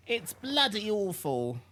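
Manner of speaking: high, slow British "bloody awful", clipped BLUD-ee; posh British accent. Shown as a demonstration. Mocking the fake British voice, a hammy British accent. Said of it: "Said in the most British way possible".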